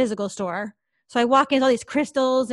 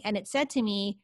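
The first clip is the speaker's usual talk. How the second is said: usual speaking voice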